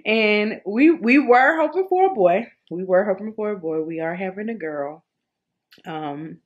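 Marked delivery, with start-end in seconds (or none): 0.0-2.5 excited. Real, not acted.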